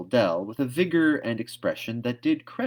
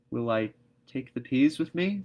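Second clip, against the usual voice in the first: a touch higher, mild meek question; meekly; 5 semitones higher, 4 dB louder than usual. A narrator's reading. Anne meekly asking Marilla, understated. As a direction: ask small and timid. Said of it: "said Anne meekly".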